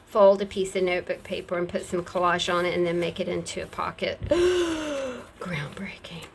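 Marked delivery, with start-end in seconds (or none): 4.2-5.3 sharp high gasp of fake awe; tongue-in-cheek gasp at her own how-to; mock gasp. 5.4-6.3 breathless whisper, much softer; hushed ironic whisper of a trivial feat; mock awed whisper. Mock awe at own tutorial.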